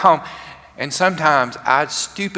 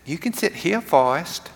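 put-on softer, higher Southern girl's voice; Southern accent; 4 semitones higher, 4 dB quieter than usual. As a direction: imitate Jenny with a gentle Southern lilt. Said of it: "still talking in that Southern accent".

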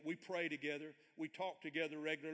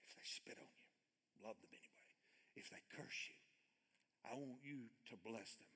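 hushed whisper; 5 semitones lower, 12 dB quieter than usual. Acted. Quoting Jesus, hushed.

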